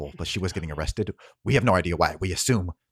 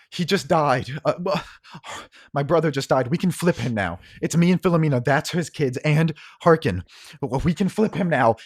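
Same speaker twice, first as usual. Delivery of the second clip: higher, louder, pressing; urgent and intense; 8 semitones higher, 6 dB louder than usual. Acted. Cersei urging Othello after her brother's death. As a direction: push the plan hard, excited. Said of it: "she turns and goes".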